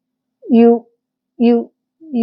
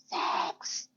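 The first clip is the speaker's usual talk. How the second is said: whispered word; 18 dB quieter than usual. Shown as a demonstration. Gossiping about the son, whispering the taboo word. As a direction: breathe sex as a hush.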